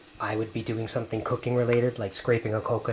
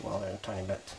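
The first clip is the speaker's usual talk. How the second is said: sheepish aside; 6 semitones lower, 13 dB quieter than usual. Real, not acted.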